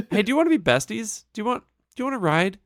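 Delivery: high, fast, bubbly fake friendliness; mock chirpy Kate. Podcaster mocking Kate picking up Claire, high and chirpy. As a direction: chirp it like a pushy new best friend.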